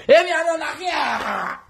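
"E mi ame na kiya" shouted high and slow; slow high yelled "E mi ame na kiya". Shown as a demonstration. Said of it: "repeated shouting".

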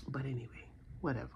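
a dismissive whisper. Real, not acted. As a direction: breathe it out, unvoiced. Brushing off fallen nail.

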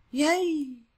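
high, loud little cheer. Real, not acted. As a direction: celebrate a level-up with a cheer. Cheers at levelling up.

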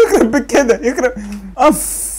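high laughing exclamation; laughing disbelief. Real, not acted. Laughing in disbelief after ambush. (ضحك مع ذهول)

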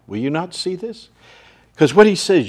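ordinary talk, nothing marked

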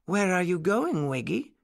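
nurse character's question. A narrator's reading. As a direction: ask it high and inquisitive.

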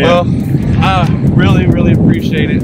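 projecting loudly outdoors; shouting over wind. Real, not acted. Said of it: "Hopefully you can hear me over the wind".

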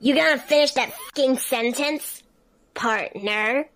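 loud high snarling threat; overacted amateur dub. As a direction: menace him, loud and raw.

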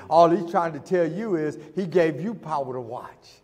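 loud, slow, high preacher's shout; preacher calling out to the church, slow and loud; preaching at full force. Acted. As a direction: stretch each word, shouting it to the church.

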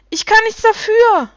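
high, loud protesting cry; calling out. A narrator's reading. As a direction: call out in your own defence, high and loud. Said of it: "rief sie".

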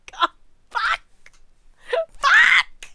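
very high squawked curse; high-pitched burst of swearing; exasperated yelp. Real, not acted. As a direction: squeal the swear word in frustration. Frustration boiling over.

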